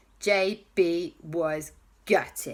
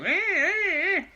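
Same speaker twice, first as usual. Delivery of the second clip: high playful rah rah; car noises; 12 semitones higher than usual. Acted. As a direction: make the cars roar like a kid. Cars crashing in the jam.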